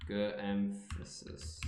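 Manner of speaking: low, soft, slow sounding-out; deliberate quiet attempt. Shown as a demonstration. Confused about what emphasis means, soft and slow. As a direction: softly spell out each syllable. Retrying the literal instruction.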